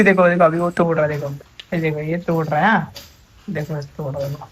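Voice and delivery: a hushed aside to viewers while the dog breaks the net. Real, not acted. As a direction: say it under your breath so the dog doesn't notice.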